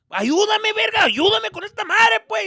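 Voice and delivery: high, loud angry father; shouted dad orders; dad yelling. Retold or imitated. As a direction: yell for help across the beach.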